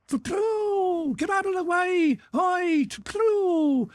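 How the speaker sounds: high shouting clearing the street. Acted. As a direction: holler at everyone to get out of the way. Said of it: "The shouting of Balagar".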